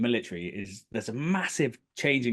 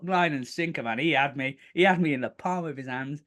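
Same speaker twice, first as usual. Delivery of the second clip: normal talking voice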